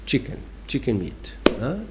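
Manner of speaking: normal talking voice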